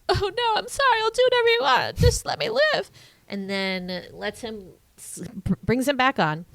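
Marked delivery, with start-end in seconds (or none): 0.1-2.9 high, squeaky mock pleading; begging villain. Gaston pleading for his life, high squeaky mock begging. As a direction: whine for mercy like a cowardly villain. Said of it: "as all stupid villains do, are like".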